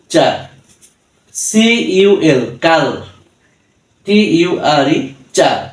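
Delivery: deliberate, segmented teacher's spell-out of culture; syllable by syllable. Shown as a demonstration. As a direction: spell each syllable, pause, then sound out কাল and চার. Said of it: "syllable ভেঙে ভেঙে".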